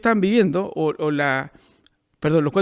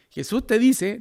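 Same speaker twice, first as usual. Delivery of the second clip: ordinary talk, nothing marked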